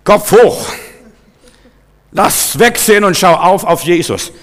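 rally yourself with a loud call; rousing pep talk. Acted. Preacher talking to himself before the mirror, loud and rousing.

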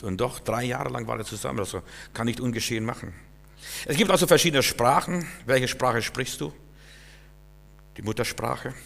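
normal talking voice

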